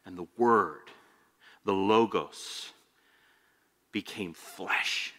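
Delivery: hushed reverent reading. Acted. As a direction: let the words settle, slow and low, full of awe. Solemn scripture reading in sermon.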